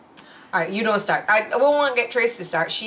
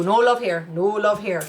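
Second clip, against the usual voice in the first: usual speaking voice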